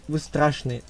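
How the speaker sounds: usual speaking voice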